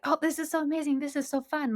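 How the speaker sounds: high, gushing over-the-top delight; gushing enthusiasm. Retold or imitated. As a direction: rave like everything is wonderful. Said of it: "But I'm like".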